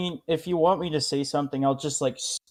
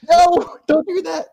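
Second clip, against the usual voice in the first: panicked shout; 10 dB louder than usual. Acted.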